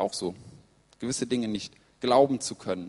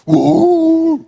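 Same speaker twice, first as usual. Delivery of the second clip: high, very loud celebratory "woo"; wild cheering whoop; 17 dB louder than usual. Acted. Mates going crazy after the match, wild cheering whoop. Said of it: "die drehen alle durch".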